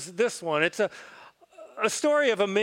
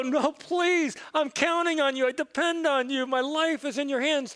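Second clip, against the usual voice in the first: higher, pleading voice rising in panic; desperate pleading; 6 semitones higher than usual. Acted. Dangling man begging the man above, panicked pleading. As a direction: beg for your life, voice climbing.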